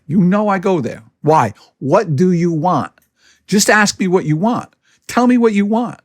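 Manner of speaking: ordinary talk, nothing marked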